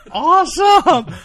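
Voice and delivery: high, loud, drawn-out Awesome!; thrilled shout. Real, not acted. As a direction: burst out in real delight. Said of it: "I was so excited".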